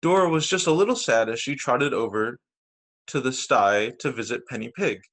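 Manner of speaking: ordinary delivery, nothing marked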